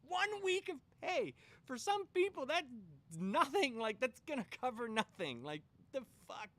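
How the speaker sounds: can't believe it, let it rip; outraged. Real, not acted. Speaker outraged at one week of severance, loud.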